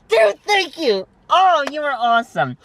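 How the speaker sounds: high, thrilled gush of thanks; excited thanks. Retold or imitated. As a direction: squeal your thanks to the generous owner. Retelling his thrilled reply to owner.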